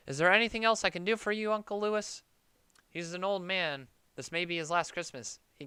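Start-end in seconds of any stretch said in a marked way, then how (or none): 0.1-2.2 sarcastic offer. Acted.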